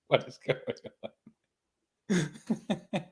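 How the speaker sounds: breaking up with laughter mid-question; laughing. Real, not acted. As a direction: giggle through the words. Laughing at a viewer.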